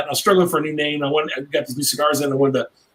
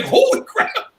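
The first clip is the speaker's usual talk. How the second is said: much higher, squeaky astonished exclamation then a laugh; stunned delight; much slower than usual. Retold or imitated. Hearing the seller say done, high astonished laugh. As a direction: blurt out disbelief and laugh. Seller accepts lowball offer.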